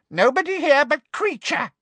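high-pitched gloating elf voice; gleeful elf. Acted. Elf tells Harry nobody is here, gleefully. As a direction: crow it gleefully, pitched right up. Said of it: "said the elf gleefully".

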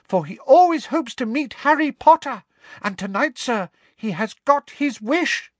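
very high, loud eager elf voice; earnest squeaky elf; squeaky house elf. Acted. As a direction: gush it out like a devoted little elf. Dobby's long speech.

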